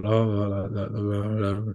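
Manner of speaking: softly mimicking a downbeat commentator; lightly mock a gloomy droning commentator; mopey commentator. Mocking the Chiefs game commentator, mild mopey drone. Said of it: "almost a bit mopey".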